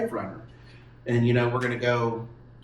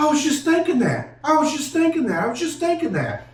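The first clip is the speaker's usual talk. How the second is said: much higher, stunned little brother repeating himself; little brother's startled voice; 4 dB louder than usual. Retold or imitated. Little brother in the truck, stunned by the voice, high and insistent. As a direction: blurt it out three times, high and shaken. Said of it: "and he goes".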